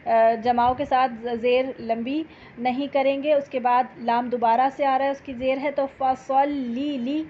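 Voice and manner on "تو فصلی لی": فصلی لی with the lam held doubled, slow; double lam. Shown as a demonstration. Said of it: "دو دفعہ لام". (فصلی لی میں دو لام)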